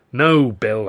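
ordinary delivery

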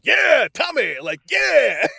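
high, loud hammed-up cheer; mock cheering shout. Friend imitating Alex cheering from ringside, loud mock cheer. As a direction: whoop it up like a ringside fan. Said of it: "watches the fight and goes".

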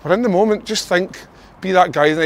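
pumped-up team talk; rousing build. Acted. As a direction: lift the room with it. Pep talk builds louder.

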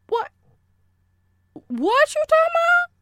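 very high, loud squawked question; over-the-top high-pitched outburst; exaggerated disbelief. Shown as a demonstration. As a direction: stop on "what", then blurt it sky-high. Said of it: "Inflection, tone, it's all in the way".

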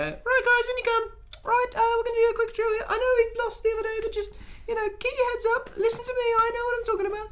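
very high-pitched, squeaky team talk; manager lacking gravitas. Acted. Podcaster mocking a manager giving the team talk without gravitas, squeaky. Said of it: "hasn't got the same gravitas as".

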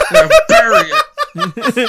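high-pitched, very loud comic yell; mock director shout. Director ordering a sweaty actor to bury it, mock shout. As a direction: yell it as an over-the-top order.

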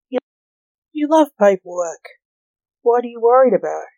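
usual speaking voice

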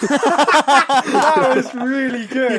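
slow, laughing praise. Real, not acted.

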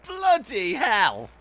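high, slow, drawn-out exclamation; real astonishment. Real, not acted. Co-host hearing the celebrity reveal, stunned. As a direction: react stunned, stretching the words out.

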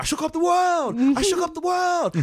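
high, loud gloating yell; hammed-up champion bragging at the top of the voice; triumphant shout as Clay. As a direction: crow it to the whole world. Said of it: "He's like".

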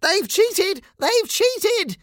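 shocked cry in a very high voice; Steve. Acted. Steve discovers the cheating, shocked high cry. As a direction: squeal it out in shock. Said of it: "cried Steve in shock".

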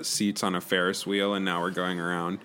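ordinary delivery

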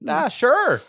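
high, loud put-on voice; crewman in character.

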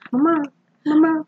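high whiny mama mama; mock high childish whine; imitating the neighbor. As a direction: call it like a clingy grown man child. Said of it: "that's what he says".

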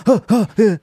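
high imitated giggle of a goofy host; mock goofy chuckle. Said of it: "Jimmy Fallon's got a bit more".

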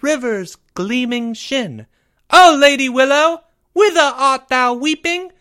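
high, slow, grand British recital voice; dramatic poetic recital in a British accent. A narrator's reading. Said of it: "with a slight British accent".

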